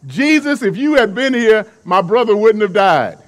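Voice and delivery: loud, blunt accusation to Jesus; blunt, flat out. Retold or imitated. Martha confronting Jesus over her brother's death, loud and blunt. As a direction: Martha lays it straight on him, loud. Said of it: "Martha is flat out".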